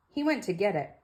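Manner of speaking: ordinary delivery, nothing marked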